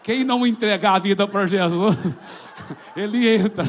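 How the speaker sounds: very loud, comic warning cry; shouted rumour punchline. Retold or imitated. Neighbourhood rumour warning who the demon enters, shouted and laughing. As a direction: belt out the scare rumour.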